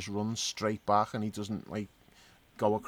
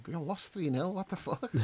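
incredulous; 8 semitones higher, 5 dB quieter than usual. Retold or imitated. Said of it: "I was like".

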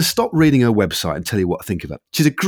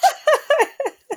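nervous laugh. Real, not acted. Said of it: "that was a bit of a nervous laugh".

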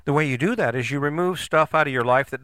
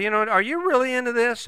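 asking wife; 11 semitones higher than usual. Retold or imitated. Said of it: "And ask her, say".

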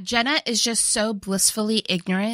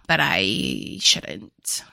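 whispered reluctance; 11 semitones lower, much slower than usual. Retold or imitated. Man confesses to the college girl that he shouldn't, slow reluctant whisper. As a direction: trail down into a drawn-out mock-reluctant whisper.

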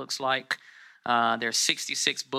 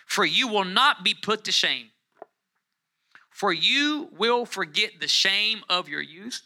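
high-pitched, measured preacher's reading; scripture proclamation; 4 semitones higher, 3 dB louder than usual. Acted. Preacher reading God's promise to the congregation, lifted proclamation.

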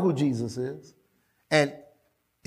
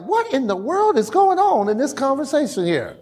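mock disbelief, leaping high on the last word; comic incredulity; bewildered disciples; 6 semitones higher than usual. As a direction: act baffled, high-pitched questioning. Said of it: "the disciples, I bet, are wondering".

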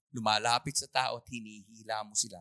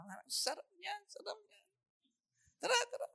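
high, soft enticing voice; tempting whisper; 5 dB quieter than usual. Pretend. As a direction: sweet-talk them into it. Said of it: "Kita mo, candy".

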